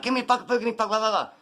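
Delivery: high-pitched imitation of ranting; mock yelled demand. Imitating him demanding food at the drive-thru, yelling. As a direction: holler a garbled demand in mimicry.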